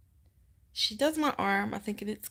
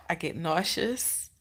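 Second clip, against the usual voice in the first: normal talking voice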